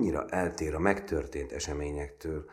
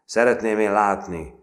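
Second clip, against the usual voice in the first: loud, raised cocky challenge; swaggering, high-pitched bluster; boasting; 6 semitones higher, 12 dB louder than usual. A narrator's reading. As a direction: brag it out loudly for all to hear. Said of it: "roppantul hetvenkedett".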